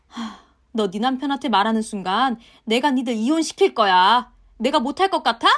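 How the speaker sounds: loud, sneering threats from the mother-in-law; yelling mother-in-law. Acted. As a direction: shout her down and threaten divorce. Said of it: "시모가 고래고래 소리를 지르는데". (고래고래 소리 지르는 시어머니)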